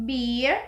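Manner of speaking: "beer" with a long, stretched E. Shown as a demonstration. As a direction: hold the vowel of "beer" before R. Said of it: "become long because".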